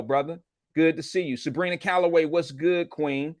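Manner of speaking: ordinary delivery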